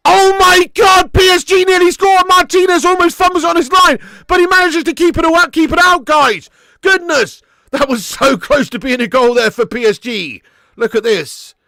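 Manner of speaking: high, very loud shouted play-by-play; excited commentary. Real, not acted. Commentator calling PSG's near goal, excited shout. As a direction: shout the near miss, easing slightly by the end.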